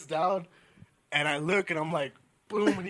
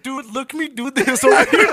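slow, higher mock Mexican accent with laughter; mocking paisa speech; 5 semitones higher, 5 dB louder than usual. Mimicking friends to their faces, slow mock Mexican accent. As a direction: drag out the put-on Mexican accent. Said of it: "we really talk like that".

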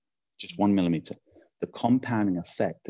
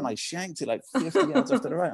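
laughing; 10 semitones higher than usual. Real, not acted. Laughing at his own bad shot.

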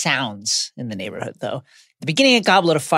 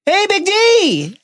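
drawn-out high taunt; taunting call; 6 dB louder, much slower than usual. Retold or imitated. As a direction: call it out slow and mocking. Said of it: "Harry calls after his cousin and taunts him".

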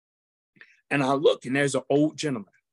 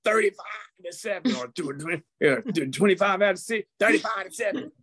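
high, playful punchline; a host ribbing the late start; joking; 7 semitones higher than usual. Retold or imitated. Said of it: "So he made jokes".

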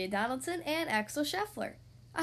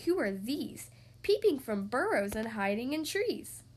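ordinary delivery

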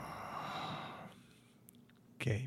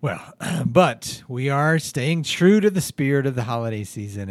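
normal talking voice

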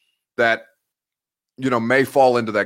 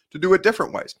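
ordinary talk

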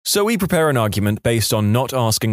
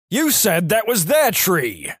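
high, drawn-out outraged cry at normal volume; high-pitched, stretched-out mock yell; mock scream; 7 semitones higher, slower than usual. A narrator's reading. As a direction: play a screaming lawyer without real volume. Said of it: "started screaming at his client".

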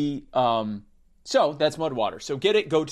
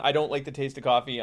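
ordinary delivery

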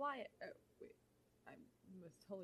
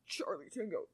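pilot radio voice; 6 semitones higher, 10 dB louder than usual. Pretend. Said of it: "I have to make it realistic".

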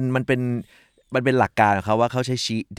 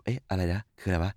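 confused mutter; 4 semitones lower, 10 dB quieter than usual. Acted. Confused cashier reacting.

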